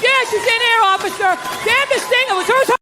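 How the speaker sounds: high, loud flustered fibbing to the officer; panicked excuse. Pretend. Caught man lying to the officer, high and loud. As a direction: squeak out a guilty excuse. Imagined talk to the cop.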